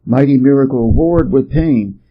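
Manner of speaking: ordinary delivery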